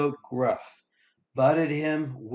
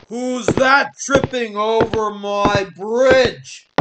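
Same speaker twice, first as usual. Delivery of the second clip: high, loud, slow troll roar; troll roaring down at the second goat; 10 semitones higher, 10 dB louder than usual. Acted. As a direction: bellow it out like a bridge troll. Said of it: "roared the troll".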